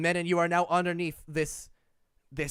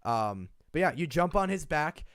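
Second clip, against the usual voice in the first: ordinary talk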